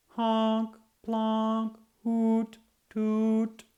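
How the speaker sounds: high loud honking sound words; horn noises. A narrator's reading. Narrator voices the trucks' horns, high and loud. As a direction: toot like toy horns.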